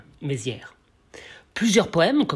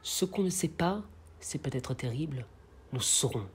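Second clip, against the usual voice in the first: soft verse, sinking lower; poem recitation; 3 semitones lower, 11 dB quieter than usual. Acted. The blacksmith ponders the unknown before the king, hushed declamation. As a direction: drop to a hushed, foreboding tone.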